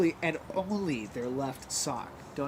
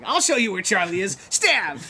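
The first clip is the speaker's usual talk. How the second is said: raised, louder threat into a shout; retold attack yell; 5 semitones higher, 9 dB louder than usual. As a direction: burst out as if lunging to attack. Retold sudden attack on the guards.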